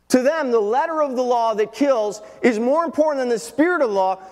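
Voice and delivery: high, driving sermon voice; full-force preaching. Acted. Preacher telling congregation what Pharisees miss, full-force preaching. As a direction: drive each phrase home from the pulpit.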